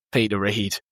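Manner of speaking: a hint of a Welsh accent; lightly put-on Welsh accent. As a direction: announce the name with a Welsh lilt. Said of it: "said in a Welsh accent".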